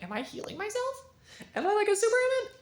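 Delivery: sorpresa aguda e incrédula; asombro. Retold or imitated. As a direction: se pregunta asombrado. R notices his body healing.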